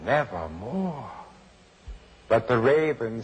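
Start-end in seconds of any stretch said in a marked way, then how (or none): 0.1-1.1 raven's croak. Acted. The raven's one-word answer.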